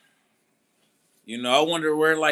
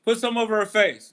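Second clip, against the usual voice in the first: mock urgent order; 5 semitones higher, 5 dB louder than usual. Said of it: "he's saying, like".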